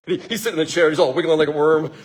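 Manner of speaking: ordinary delivery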